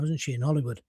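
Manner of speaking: normal talking voice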